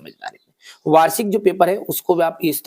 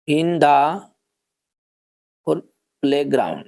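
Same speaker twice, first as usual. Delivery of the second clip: drawn out with long gaps between words; slow word-by-word board dictation; dictating while writing; 3 semitones lower, much slower than usual. Shown as a demonstration. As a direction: say each word as you write it on the board. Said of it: "यहां पर मैं पहला लिख देता हूं".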